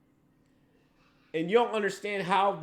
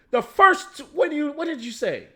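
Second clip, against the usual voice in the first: much louder and higher, near a shout; heated, raised voice; heated outburst; 8 semitones higher, 7 dB louder than usual. Real, not acted. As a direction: cut back in hard to regain the floor. Restarts the point, heated, to Dylan.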